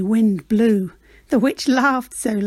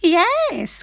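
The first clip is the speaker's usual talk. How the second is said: high witch exclaiming; a glad quick yes; the witch welcoming the frog aboard; witch crying yes; 7 semitones higher than usual. Acted. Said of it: "cried the witch".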